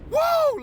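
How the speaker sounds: high, loud joyful whoop; ecstatic whoop. Real, not acted. Morning preacher whooping for his viewers, ecstatic whoop. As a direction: let out a wild happy whoop. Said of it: "Man, I get excited about this".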